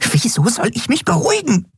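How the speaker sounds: shrill, high-pitched indignation; agitated outburst. A narrator's reading. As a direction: snap back, refusing to calm down. Refuses to calm down, flutters agitatedly. (aufgebracht und schrill)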